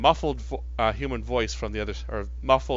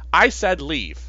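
stern warning; 5 semitones higher, 7 dB louder, slower than usual. Acted. NPC aims pistol, warns again.

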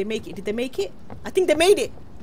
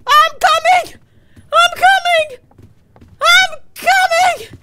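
very high, loud shriek; panicked scream; 9 dB louder, slower than usual. Real, not acted. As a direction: scream to teammates in a frenzy. Fleeing in panic to teammates.

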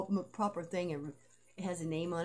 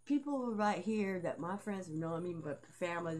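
ordinary talk, nothing marked

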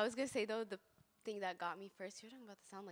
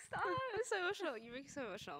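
high-pitched plea, about to cry; emotional, near tears; 11 semitones higher than usual. Real, not acted. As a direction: overcome with feeling, voice jumping up high. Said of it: "I'm about to cry right now".

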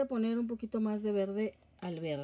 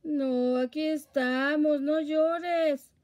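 loud, high, soothing puppy talk; comforting a crying puppy; 6 semitones higher, 7 dB louder, much slower than usual. Real, not acted. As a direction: coo loudly to calm the dog. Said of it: "pero luego lo consuelo".

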